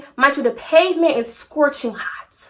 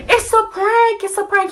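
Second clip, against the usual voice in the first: high pleading; 8 semitones higher than usual. Retold or imitated. Chasing her boyfriend down the street, pleading high. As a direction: voice up high, begging him to believe it's a prank.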